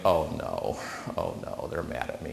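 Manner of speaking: hushed, whispered dread; inner panic. Retold or imitated. Panicking to himself as the client calls, whispered dread. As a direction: breathe it out in sinking panic. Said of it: "my stomach just drops, like".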